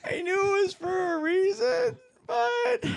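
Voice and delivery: squeaky voice, very high; highest voice. Acted. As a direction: push your voice as high as it goes. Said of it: "Okay, that in my highest voice".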